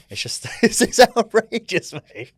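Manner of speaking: high, loud burst of laughter through the words; cracking up at the memory; laughing. Real, not acted. Laughing at the crab hand team name, high and loud.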